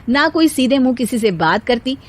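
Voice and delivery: ordinary delivery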